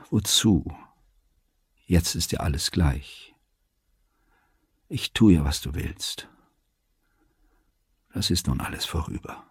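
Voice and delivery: soft, weary resignation fading out; müde und kummervoll. A narrator's reading. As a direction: give up, voice sinking to a breath. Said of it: "müde klang auch ihre Stimme". (leise, müde, kummervoll)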